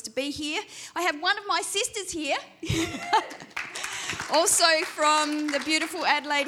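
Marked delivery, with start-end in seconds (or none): 2.4-4.2 high, airy giggle; breathy laugh. Real, not acted. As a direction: giggle with delight. Laughs about sister being here.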